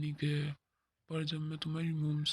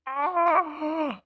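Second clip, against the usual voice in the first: scream; 13 semitones higher, 12 dB louder than usual. Acted. Man sees the mermaid.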